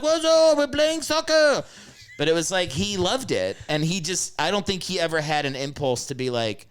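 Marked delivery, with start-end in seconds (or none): 0.0-1.6 put-on high, loud, carefree old Frenchman; hammed-up French grandpa protest; French grandfather. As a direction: holler back defensively in a high voice. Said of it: "my French grandfather".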